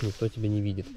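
usual speaking voice

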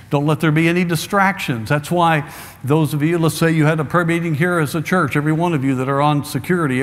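ordinary talk, nothing marked

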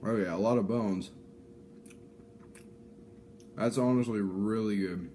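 eating between words; talking while chewing. Real, not acted. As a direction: mouth partly full. Seen 0: Bites at -4, then closed-mouth chewing while speaking, fish in hand.

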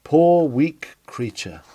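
ordinary talk

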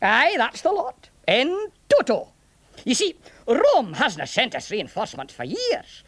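lilting Scots burr; chatty old Scots soldier, pitch swooping; Scottish centurion, explaining. Acted. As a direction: explain matters in a Scottish accent.

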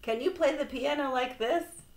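high, loud showing off; boastful cry. A narrator's reading. Toad boasting to Frog from stage, crying out. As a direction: call it out to show off. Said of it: "cried Toad".

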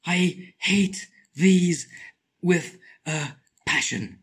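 very slow, stretched I hate these; drawn out. Shown as a demonstration. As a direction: drag every word out.

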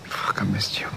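low, hushed whisper; tender confession. Acted. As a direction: murmur it softly, very low. Intimate admission between partners.

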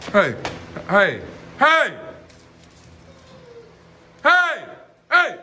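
loud, rising hey to stop him; sharp warning shout. Real, not acted. Owner shouts hey to stop the dog, loud and high.

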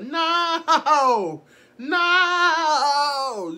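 a loud, high, laughing "No!"; laughing refusal. Retold or imitated. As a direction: refuse through the giggles, horrified. Refusing to be lookout.

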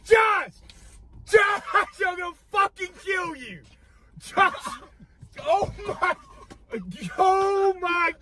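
shrieking outrage. Real, not acted. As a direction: shriek in disgust at a fart. Freaking out at fart.

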